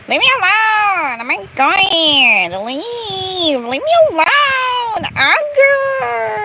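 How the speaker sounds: building from high whine to shriek; hammed-up squealing plea, drawn out; high-pitched wailing. Hammed-up pleading as game harasses them.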